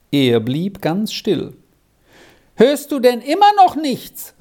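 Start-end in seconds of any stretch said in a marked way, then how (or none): 2.6-4.3 high, insistent shouted question; impatient call. A narrator's reading. Squirrel upstairs prods Arthur, calling impatiently. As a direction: call down, losing patience. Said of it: "rief Squirrel ungeduldig".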